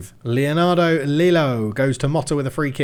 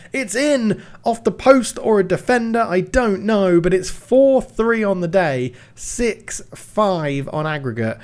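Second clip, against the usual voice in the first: excited commentary; 6 semitones higher than usual. Real, not acted. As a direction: shout the goal out. Winning goal commentary.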